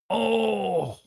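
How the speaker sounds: long, high falling "oh"; dismayed groan. Real, not acted. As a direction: let out a drawn-out, high cry of dismay. Reacting to player in trouble.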